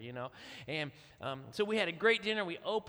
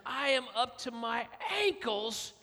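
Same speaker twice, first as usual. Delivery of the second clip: much higher, loud outraged yell; rising to a shout on the last words; wife covered in mud yelling at husband; furious shout; 8 semitones higher, 5 dB louder, slower than usual. Retold or imitated. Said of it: "She goes".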